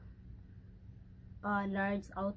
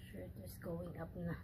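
ordinary talk

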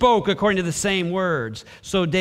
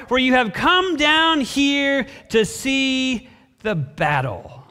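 slow, raised accusation dropping at the end; angry scorn; 5 semitones higher, slower than usual. A narrator's reading. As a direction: draw it out scornfully. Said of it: "Eliab's anger was aroused against David".

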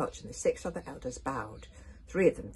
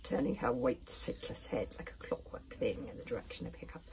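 usual speaking voice

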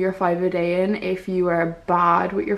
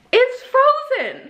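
dismayed; much slower than usual. Real, not acted. Dismay at frozen smoothie.

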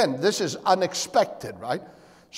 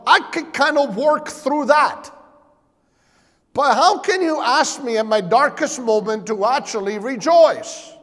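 higher, louder, mock disbelief at Jesus; incredulous listener; 5 semitones higher, 4 dB louder than usual. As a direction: play the doubter who can't believe it. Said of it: "Now you say".

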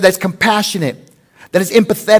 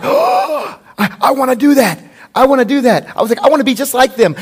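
put-on awestruck kid; 8 semitones higher, 3 dB louder than usual. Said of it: "I'm like".

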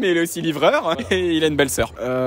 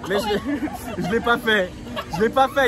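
ordinary talk, nothing marked

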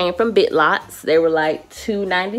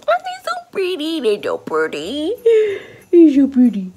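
gushing squeal; 9 semitones higher, 4 dB louder than usual. Real, not acted. Admiring the flowers.

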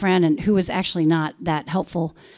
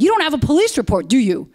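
higher, louder, fast accusing challenge; retold angry yell; 7 semitones higher, 6 dB louder than usual. Board member's dad yelling at her at the meeting, higher and louder. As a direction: throw it at her like a cross-examiner. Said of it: "He yelled at me. He kept cutting me off".

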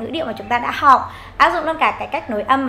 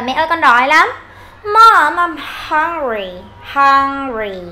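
put-on hungry child calling mom; 5 dB louder, slower than usual. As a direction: whine to mom that you are hungry. Child complaining of hunger to mother.

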